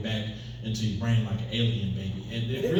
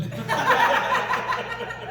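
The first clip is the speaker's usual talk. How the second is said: high burst of laughter. Real, not acted. Comedian laughing at his own gun joke.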